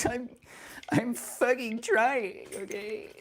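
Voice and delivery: high, drawn-out exasperated whine. Real, not acted. Streamer protesting to impatient chat, exasperated. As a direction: protest in a strained high pitch.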